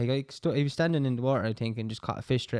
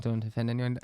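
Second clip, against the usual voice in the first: ordinary talk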